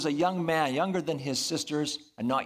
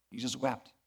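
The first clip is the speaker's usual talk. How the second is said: softer and lower, let it sink in; hushed repeat; 3 semitones lower, 7 dB quieter than usual. Shown as a demonstration. Preacher repeats the verse to the congregation, hushed and softer. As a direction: say it again almost under the breath, reverently.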